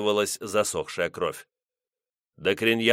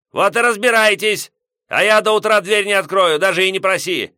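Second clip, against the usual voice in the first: put-on grumpy old man; 11 semitones higher, 8 dB louder than usual. Headman yelling back.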